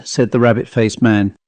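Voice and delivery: ordinary delivery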